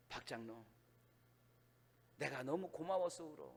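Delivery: low, very soft, moved voice; retold tearfulness. Pastor thanking the elder through tears, retold softly. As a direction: imitate tearful gratitude, hushed and low. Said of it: "울면서 대성통곡하는".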